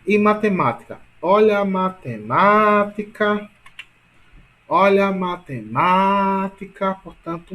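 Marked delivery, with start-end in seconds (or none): 1.2-3.5 matemática called out with MA stretched long and loud; stress on MA. Shown as a demonstration. Teacher showing students the strongest syllable, MA stretched out. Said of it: "ma é a mais forte". 4.7-6.9 matemática called out with MA stretched long and loud; stress on MA. Shown as a demonstration. Teacher showing students the strongest syllable, MA stretched out. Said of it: "ma é a mais forte".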